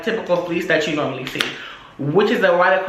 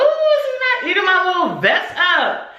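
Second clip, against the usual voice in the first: high-pitched put-on indignation; mock offended; 10 semitones higher than usual. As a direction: act out a high, offended aside. Acting out her offended reaction.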